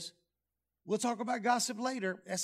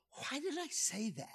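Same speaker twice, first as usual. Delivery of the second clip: mock regret; 3 semitones higher, 9 dB quieter than usual. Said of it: "and go".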